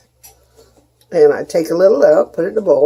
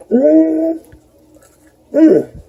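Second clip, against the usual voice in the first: delighted mm; 11 semitones higher, 4 dB louder than usual. Real, not acted. Tasting the cookie.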